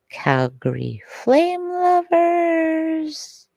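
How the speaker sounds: high, drawn-out crowd call; hyped-up call-out. Pretend. Streamer calling out to Calgary hockey fans, drawn-out hyped call. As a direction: stretch it out high like an arena announcer.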